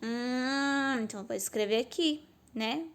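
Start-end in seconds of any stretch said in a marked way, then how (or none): none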